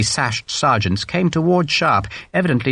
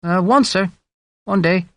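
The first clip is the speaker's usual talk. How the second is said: hesitant, higher-pitched reply; a touch of nerves; nervous young soldier; 6 semitones higher than usual. A narrator's reading. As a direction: answer an officer uneasily. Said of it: "The boy looked nervously towards his own sergeant".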